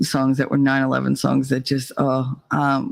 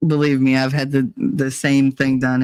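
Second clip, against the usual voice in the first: ordinary talk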